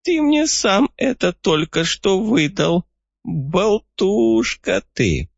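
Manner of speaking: put-on high, slow teasing old codger; gloating old man. A narrator's reading. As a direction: crow over the boy like a sly old man. Old man gloats, rubbing paws.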